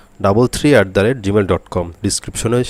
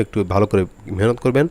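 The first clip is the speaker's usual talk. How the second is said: ordinary talk, nothing marked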